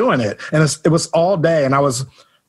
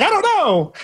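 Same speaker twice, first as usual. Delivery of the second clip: laughing; 6 semitones higher than usual. Retold or imitated. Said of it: "I'm like".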